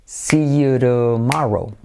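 "see you tomorrow" slowly linked as "siyu-ro-MA-ro"; slow drill of "see you tomorrow" with tapped T; slow repeat-after-me model. Shown as a demonstration. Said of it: "repite conmigo".

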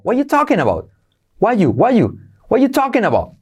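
casual high reduced. Shown as a demonstration. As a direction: pitch it up, drop the verb. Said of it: "Lo salto".

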